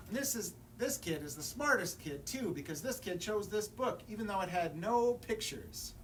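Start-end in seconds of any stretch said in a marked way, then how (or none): none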